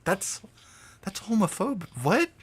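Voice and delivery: high incredulous protest; mock shocked. Streamer replays his own shocked reply to Mike, high and mock shocked. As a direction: pretend to be scandalized, pitch up. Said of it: "And I'm like".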